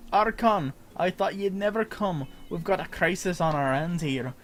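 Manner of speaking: NPC voice. Acted. Streamer voicing an NPC urgently greeting the player, pirate-Scottish accent. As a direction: call out like a relieved old salt. Reading NPC dialogue aloud.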